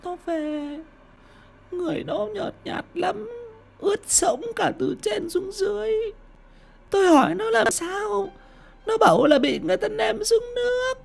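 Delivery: put-on crying old mother. Mother crying to her husband that their daughter died, high and sobbing. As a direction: weep out the news of her death. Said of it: "Bà cụ Lan bật khóc".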